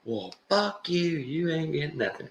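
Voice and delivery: high, indignant mock tirade; going off on him. Man insulted by the homeless guy, mock outrage. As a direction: snap back like an offended jerk. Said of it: "He just starts going off on the guy".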